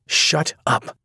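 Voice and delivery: high, curt angry snap; sharp shut-up. A narrator's reading. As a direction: cut him off sharply. Sloane cuts him off.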